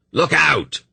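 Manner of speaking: high, urgent warning cry; shouted warning. A narrator's reading. Dernick warns Pol of the whirlpool, shouting. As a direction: shout out sharply to warn of danger. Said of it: "Dernick shouted".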